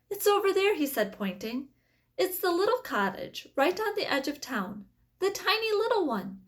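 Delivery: high, lightly voiced eager boy. A narrator's reading. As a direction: point it out like an excited child.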